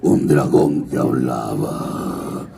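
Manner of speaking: very low, slow voice from the gut; dragon voice. Shown as a demonstration. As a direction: speak like a fire-breathing dragon. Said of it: "¿Ven cómo va cambiando la voz?".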